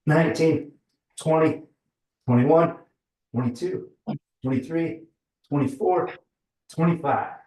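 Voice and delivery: straining count of push-ups; straining through push-ups. Real, not acted. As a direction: count each rep as you push up. Counting out loud during push-ups.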